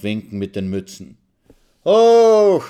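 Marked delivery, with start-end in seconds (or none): 1.8-2.6 a high, loud festive cheer; cheering shout. A narrator's reading. Soldiers cheering from train.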